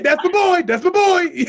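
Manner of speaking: high, loud mock cheering; playful, over-the-top bragging shout; proud hype yell. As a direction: holler like a fan behind the fighter. Acts out proud cheering from behind.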